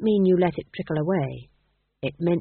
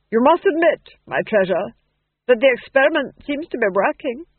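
high, loud theatrical duke; duke pleased with himself; 6 semitones higher, 7 dB louder than usual. A narrator's reading. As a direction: press the point on a wife. Duke addresses his duchess.

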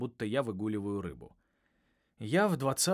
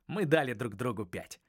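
ordinary delivery, nothing marked

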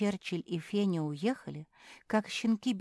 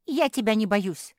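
loud, higher dare; defiant interruption; 5 semitones higher, 7 dB louder than usual. A narrator's reading. Жози defying the sister in charge, brazen. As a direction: brazenly stand up to the boss. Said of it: "перебила её Жози".

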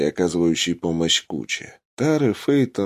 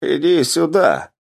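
high, raised call to come over; 9 semitones higher, 4 dB louder than usual. A narrator's reading. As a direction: summon the commander from a distance. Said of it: "окликнул командира ещё один боец". (окликает, подзывая к себе)

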